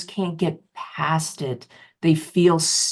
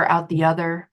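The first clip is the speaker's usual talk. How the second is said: ordinary talk, nothing marked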